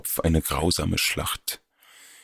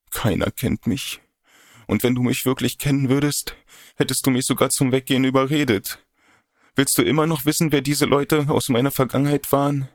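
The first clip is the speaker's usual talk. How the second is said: higher, fast, hurt reproach; upset young woman; 7 semitones higher, faster than usual. Acted. Odette reproaches her lover for not holding her back, climbing hurt. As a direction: accuse him, voice climbing as the hurt grows.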